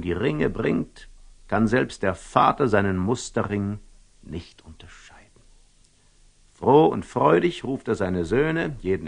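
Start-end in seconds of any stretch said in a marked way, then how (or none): 4.2-5.4 soft unvoiced whisper on "nicht unterscheiden"; whispered wonder in the story; storyteller's whisper. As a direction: hush the ending to a whisper. Storytelling hushed remark.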